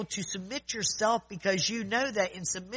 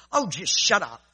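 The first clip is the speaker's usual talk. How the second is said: louder, fed-up snap of shut up; angry retort; 8 dB louder than usual. Retold or imitated. Angry man cutting off the calm speaker, retold loudly. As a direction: mimic a man losing his patience. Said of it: "and finally he said".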